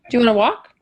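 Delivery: normal talking voice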